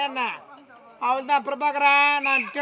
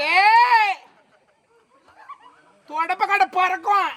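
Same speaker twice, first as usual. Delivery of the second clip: loud, high yell, then a slow threat; comic scolding at full voice; acted angry threat; 6 semitones higher, 4 dB louder, much slower than usual. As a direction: warn him off with a drawn-out shout. Mother's acted threat to husband.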